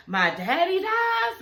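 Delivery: put-on high, slow little girl voice; a small child saying her daddy died. Quoting the little girl in a high, slow child voice. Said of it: "the little girl says".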